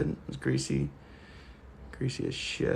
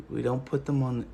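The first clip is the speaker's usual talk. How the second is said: ordinary talk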